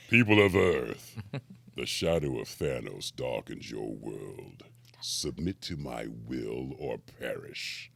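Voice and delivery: low, slow villain proclamation; booming arrogant alien overlord. Acted. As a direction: announce doom over the planet. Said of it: "I'm like".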